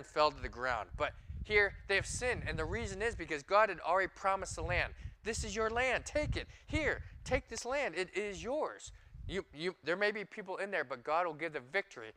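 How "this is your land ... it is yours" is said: high, slow, insistent offer; a hint of God's earnest offer. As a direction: hand over the land with urging. God's voice giving the land.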